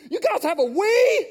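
put-on high, slow, wide-eyed kid asking; a boy marvelling at the game room, high and drawn out; excited kid voice. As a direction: play the awed fifth grader. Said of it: "kid voice again".

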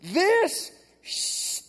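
put-on high, loud, slow amazed kid; a boy telling his friend out loud in church; kid blurting out loud. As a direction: let it slip out, thrilled. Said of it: "says out loud in the whole room".

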